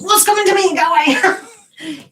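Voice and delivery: high, loud comic protest ending in laughter; mock shouting. Using the funny English expression, mock shouting. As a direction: yell it playfully, laughing at the end. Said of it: "use that expression".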